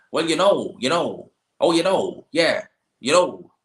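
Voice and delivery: high, loud mock West Coast Irish rambling; West Coast Irish accent. Imitating a West Coast Irish local's answer to a Dublin visitor, high and loud. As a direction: do a West Coast Irish local who can't get to the point. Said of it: "That's what they're like".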